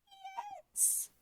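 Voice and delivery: tiny high squeak, very soft. A narrator's reading. Wolf fakes a tiny squeak at Granny's door. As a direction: squeak it like a wolf faking a small voice. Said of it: "squeaked the wolf".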